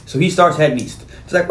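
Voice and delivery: normal talking voice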